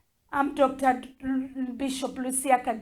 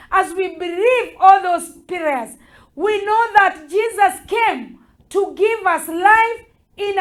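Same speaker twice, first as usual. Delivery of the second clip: emphatic sermon delivery with punched key words; preaching; 5 semitones higher, 8 dB louder than usual. Acted. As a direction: preach it in firm, rising and falling phrases. Preaches and leads prayer.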